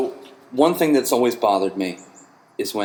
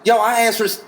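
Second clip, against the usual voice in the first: worked-up rant; 9 semitones higher than usual. Real, not acted. Bartender ranting about building his own burger, high exasperated. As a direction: vent at the waiter, fired up.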